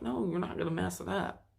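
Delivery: usual speaking voice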